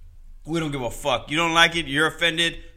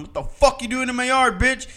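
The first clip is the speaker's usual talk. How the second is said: raised, higher, louder confrontation; angry yelling; 11 semitones higher, 8 dB louder than usual. Retold or imitated. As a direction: bark it at the intruder like you mean it. Confronting stranger in his yard.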